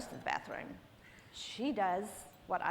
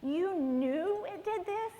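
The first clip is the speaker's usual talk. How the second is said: incredulous; 7 semitones higher, slower than usual. Retold or imitated. Acted confrontation with the girl.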